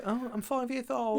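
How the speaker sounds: put-on high, slow little-kid voice; mock five-year-old. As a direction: put on a small child's voice. Imitates a five-year-old child.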